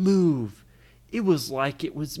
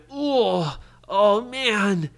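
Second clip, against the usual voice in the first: sick groaning; 6 semitones higher, 6 dB louder, much slower than usual. Acted. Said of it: "He felt so sick".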